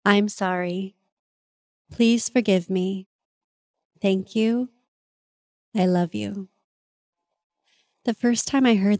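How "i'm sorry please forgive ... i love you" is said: slow, solemn recitation of the prayer; measured, reverent prayer with pauses. Shown as a demonstration. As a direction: intone each phrase like a sacred mantra. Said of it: "it goes a little something like this".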